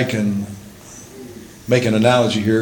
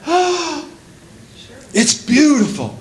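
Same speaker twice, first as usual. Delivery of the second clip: high gasp, then raised, wondering voice; acted breathless delight; awed admiration; slower than usual. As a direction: gasp and marvel at something new. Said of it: "I think we all go".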